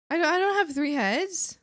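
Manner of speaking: can't believe what she just heard; surprised disbelief. Real, not acted. Shocked to learn of three heads.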